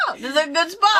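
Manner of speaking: high imitated child, half laughing; little girl. Little girl choosing where to pee, lightly mimicked high. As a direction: play the pleased kid picking a spot.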